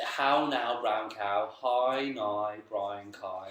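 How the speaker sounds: "How Now Brown Cow" with every "ow" said as "eye", slow; Northern Irish how now brown cow. Shown as a demonstration. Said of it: "that's how I pronounce it".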